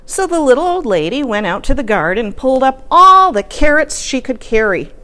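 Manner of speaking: ordinary delivery, nothing marked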